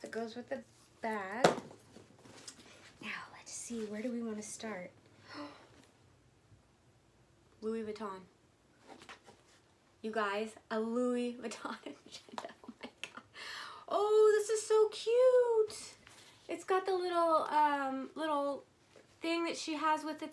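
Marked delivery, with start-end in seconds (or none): 3.0-3.8 hushed whisper, lowering the voice; soft whisper. Real, not acted. Whispering to viewers before opening the next item. 12.7-13.2 breathless whispered oh my God; awed whisper. Real, not acted. Whispering in awe at the Louis Vuitton agenda. 13.9-20.3 high, loud, slow delighted squeal; squealing excitement. Real, not acted. Squealing with joy over a gifted agenda.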